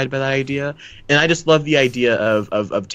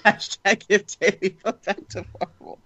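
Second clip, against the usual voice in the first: laughing; 10 semitones higher than usual. Real, not acted. Joking slogan while laughing.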